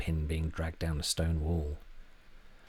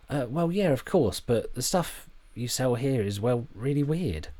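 higher, slow and awkward; hesitant; 7 semitones higher, 3 dB louder than usual. A narrator's reading. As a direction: fumble for polite words.

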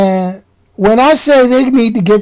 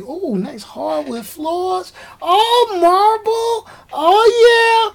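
much higher, soft, drawn-out oohs; impressed oohing; 10 semitones higher, 4 dB quieter, much slower than usual. Retold or imitated. Walking into the resort room, first impressed by the floors, breathy high oohs. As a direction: coo like you're dazzled by a fancy room. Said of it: "I was like".

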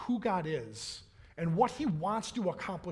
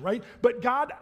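ordinary talk

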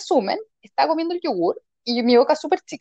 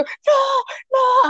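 panicked no; 12 semitones higher than usual. Retold or imitated. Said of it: "y yo".

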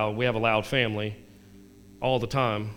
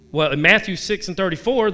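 ordinary talk

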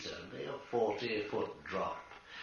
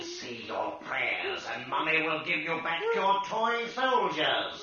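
louder, higher mocking mother; coaxing mother; 6 semitones higher, 8 dB louder than usual. Ghost as Mummy bribing Ronald to pray. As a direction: coax him like a child.

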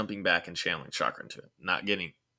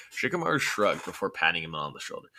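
ordinary delivery

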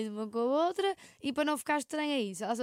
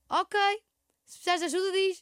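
higher, louder call to the next room; 7 semitones higher, 5 dB louder than usual. Retold or imitated. Calling from her room to her crying flatmate. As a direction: call out helpfully through the wall. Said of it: "E eu".

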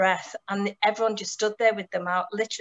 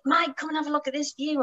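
enthusiastic Martin; 7 semitones higher than usual. Retold or imitated. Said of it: "Martin was being really enthusiastic".

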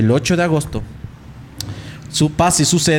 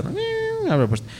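ordinary talk

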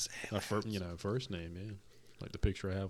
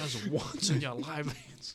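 slow, higher, drawn-out plea; pleading; 7 semitones higher, 4 dB louder, slower than usual. Pretend. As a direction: beg him dramatically. Urging him to answer.

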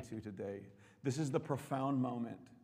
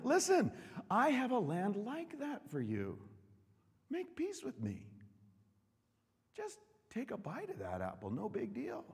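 Satan's coaxing voice; 10 semitones higher, 4 dB louder than usual. Acted. Preacher playing Satan luring a believer, coaxing. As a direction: sweet-talk them into taking the bite.

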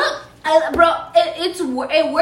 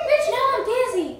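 high mimic of her friend's line; a much higher copy of her words; mocking repeat; 9 semitones higher than usual. Repeats friend's dizzy complaint back in a high voice. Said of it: "What was that sound effect?".

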